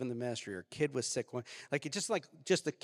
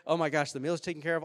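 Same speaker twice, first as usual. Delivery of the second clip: ordinary talk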